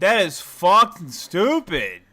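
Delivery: loud frustrated yelling; angry shout. Real, not acted. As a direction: shout it in disgust. Frustrated reaction to putt.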